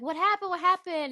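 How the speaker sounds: high, panicked repeat; startled questioning in a high voice; alarmed. Retold or imitated. Daughter seeing kitchen fire, alarmed high question.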